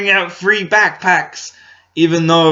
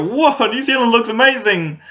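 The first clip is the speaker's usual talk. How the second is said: much higher, gushing mocking wonder; mock awe; 10 semitones higher than usual. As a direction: lampoon a wide-eyed tourist.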